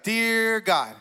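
imitating a slow, drawn-out prayer. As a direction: stretch each word as if God needs it slow. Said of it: "He was talking a little slower than he normally does".

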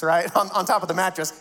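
ordinary talk, nothing marked

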